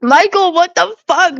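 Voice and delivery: high shocked outburst; startled "what the fuck"; surprised. Real, not acted. Reacting to chat.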